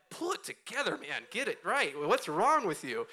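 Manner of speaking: fighting tears, telling himself to pull it together; self-scolding. Retold or imitated. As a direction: scold yourself to hold back tears. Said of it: "I'm like".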